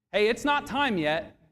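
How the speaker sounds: ordinary talk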